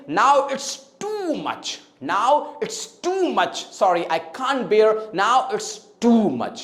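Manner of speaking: acted protest with 'too' drawn out; slow, emphatic long oo in 'too'; protest, dragging out the word 'too'; stress on TOO. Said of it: "तो आप क्या कहेंगे".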